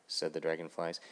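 ordinary delivery, nothing marked

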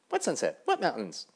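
loud, high, boastful dragonflies; brash dragonfly voice. Acted. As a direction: call out high and loud, showing off.

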